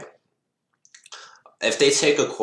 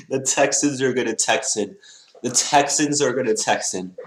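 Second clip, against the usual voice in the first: amused mockery; 5 semitones higher than usual. Real, not acted. Mocking the team, still amused.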